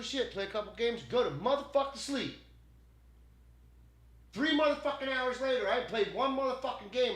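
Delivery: high, loud angry rant; real pissed off. Real, not acted. Streamer ranting about three wasted gaming hours, pissed off. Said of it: "I'm so fucking pissed off".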